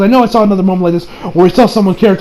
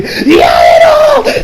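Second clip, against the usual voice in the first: much higher, slow wailing shout of the name; meme scream; much slower than usual. Retold or imitated. Hamming up the heroine's cry for the meme, a wailing shout. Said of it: "I'm just gonna say "Yamada".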